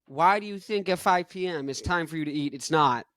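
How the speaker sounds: ordinary talk, nothing marked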